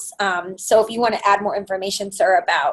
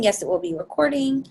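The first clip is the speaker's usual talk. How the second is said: normal talking voice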